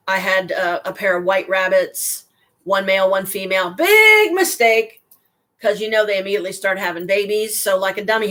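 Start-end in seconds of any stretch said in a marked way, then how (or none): 3.7-5.0 high, slow, louder groan of regret; drawn-out rueful high cry; rueful exclamation. Real, not acted. Looking back on buying the rabbit pair, a slow rueful cry.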